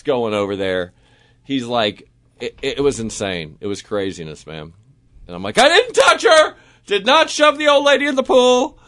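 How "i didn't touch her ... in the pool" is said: very high, loud yelp of innocence; hammed-up frantic shouting in self-defense; mock panicked protest. Accused man protests to the judge by the pool, mock panic.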